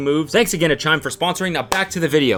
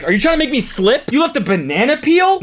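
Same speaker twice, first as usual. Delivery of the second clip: much higher, louder indignant squeal; shrill mock outrage; 13 semitones higher, 6 dB louder than usual. Friend imitating the cleaning lady about the banana, squealing outrage. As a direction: squeal it like she's been wronged. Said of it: "She's like".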